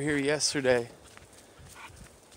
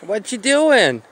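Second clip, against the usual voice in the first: high, loud playful sing-up on a drawn-out question; pet talk; 8 semitones higher, 7 dB louder, slower than usual. Pretend. Owner playfully quizzing the pet, high pet-talk voice. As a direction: coo it loudly, like talking to a dog.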